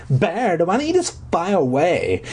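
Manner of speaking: lightly voiced mocking girl; a hint of Judith's sneer. Boy mimics Judith taunting him, light sneer. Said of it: "sneered at me and said".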